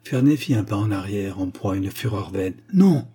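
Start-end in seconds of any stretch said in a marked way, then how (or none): 2.7-3.1 high, loud desperate cry of no; protesting in despair. A narrator's reading. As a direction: shout it out, cornered and desperate. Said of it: "protesta-t-il avec l'énergie du désespoir". (un non crié, plus aigu et plus fort, désespéré)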